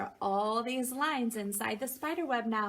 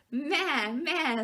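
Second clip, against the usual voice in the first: a touch higher, loud goat bleat; 5 semitones higher, 5 dB louder than usual. Acted. Parent reading to a child, goat bleats loudly. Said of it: "said the goat".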